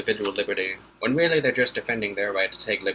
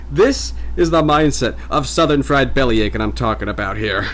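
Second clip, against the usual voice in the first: ordinary talk, nothing marked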